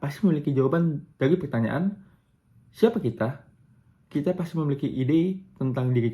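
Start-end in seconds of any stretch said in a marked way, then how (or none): none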